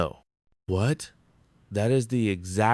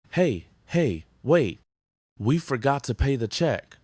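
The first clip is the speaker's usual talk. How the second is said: urgent, higher-pitched call to stop; 5 semitones higher than usual. Acted. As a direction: call after them in a higher voice. Calls out about unpaid check.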